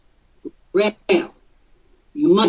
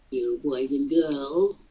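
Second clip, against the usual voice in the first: put-on slow, soft and lower, gentle children's TV host; Mister Rogers; 5 semitones lower, 7 dB quieter than usual. Boss mimics Mister Rogers to staff, slow and soft. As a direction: talk down slowly like a kindly TV host to toddlers. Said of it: "he said".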